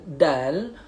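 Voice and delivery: usual speaking voice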